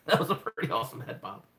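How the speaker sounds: loud, amused burst with a laugh. Real, not acted. Cuber watching their own reaction replay, laughing. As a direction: laugh out loud, then say it loudly.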